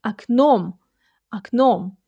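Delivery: окном said ak-NOM; окном, a first, full o in the stressed end. Shown as a demonstration. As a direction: say окном with an opening a and stress on the second syllable. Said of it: "first O sounds like A because the stress".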